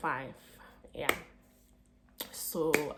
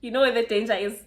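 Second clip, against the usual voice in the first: louder, quick, laughing; excited laughing question; 3 semitones higher, 8 dB louder, much faster than usual. Real, not acted. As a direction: blurt it out with a laugh. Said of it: "repeats the question calmer".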